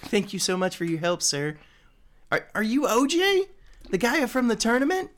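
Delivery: high, eager little squirrel voice; squirrel person voice. Acted. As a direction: chirp it up like a grateful squirrel. GM voices squirrel NPC again.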